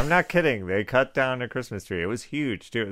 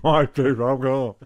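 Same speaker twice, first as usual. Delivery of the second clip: gummy, slow, louder, with laughter; toothless; 4 semitones higher, 9 dB louder, slower than usual. Shown as a demonstration. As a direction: say it with no teeth, lips mushy. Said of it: "it would have sounded like this".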